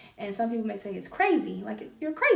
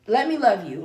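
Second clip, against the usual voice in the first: louder, pressing "let me love you"; loud plea; 8 dB louder than usual. Acted. Begging someone to accept her love, louder plea. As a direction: push the plea out, urgent. Pleading openness, repeated.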